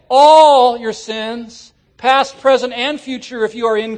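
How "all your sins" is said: "All" drawn out slow and loud; preacher stretching "All" to mean every one; stress on all. Shown as a demonstration. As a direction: hammer "All", very slowly. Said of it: "the word all means all".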